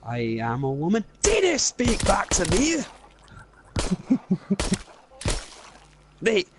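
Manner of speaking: mock him into a whiskey tour; Scottish teasing.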